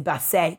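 "basset" as bass-AY; French pronunciation. Retold or imitated. Quoting those who dared say it so, French "basset". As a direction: end "basset" on a French ay sound. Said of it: "if anyone dared pronounce it basset".

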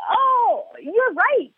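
high-pitched apologetic "oh"; exaggerated contrition. Mom backpedaling to her son, high exaggerated contrition. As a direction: concede sweetly, way up high.

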